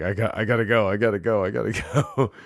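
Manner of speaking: stifled laughter. Retold or imitated. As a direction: hurry off, barely holding the laugh in. Fleeing while trying not to laugh.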